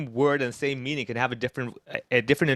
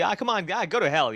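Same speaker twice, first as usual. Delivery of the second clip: higher-pitched, casual dismissal; casual brush-off; 5 semitones higher than usual. Acted. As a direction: wave a friend off without real malice. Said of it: "in English, you're like, "Ah, whatever".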